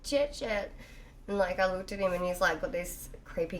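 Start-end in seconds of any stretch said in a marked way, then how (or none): 0.0-0.7 put-on high anxious child calling; little girl voice. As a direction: call the name like a worried kid. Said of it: "she's like".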